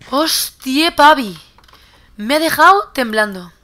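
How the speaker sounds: loud, rattled relief after a fright; real shock, loudly voiced; shaken exclamation. Real, not acted. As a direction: exclaim as if still jolted. Still shaken after the sudden attack.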